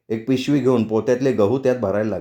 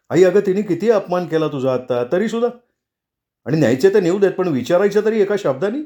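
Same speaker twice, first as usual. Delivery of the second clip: higher, heated objection; indignant protest; 6 semitones higher than usual. A narrator's reading. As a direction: protest indignantly on her behalf. Kedar protests his mother's insult.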